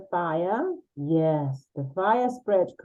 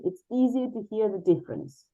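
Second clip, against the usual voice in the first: ordinary talk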